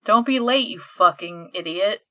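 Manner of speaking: ordinary talk, nothing marked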